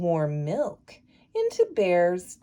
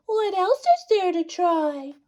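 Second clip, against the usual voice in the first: little wren voice; 10 semitones higher than usual. Acted. Wren wondering what to try.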